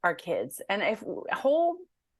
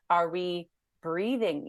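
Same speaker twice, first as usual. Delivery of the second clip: usual speaking voice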